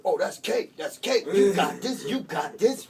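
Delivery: loud, high, hammed-up inmate hype; hyped encouragement. Inmate hears it's only two months, loud mock hyping-up. Inmate cheers him on, acted.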